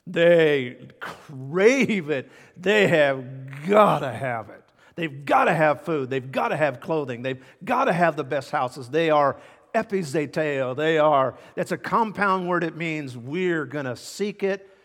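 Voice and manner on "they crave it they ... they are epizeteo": loud, pounding preacher cadence; preaching at full force. Acted. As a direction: drive each line home like a revival preacher. Preaches about worldly craving.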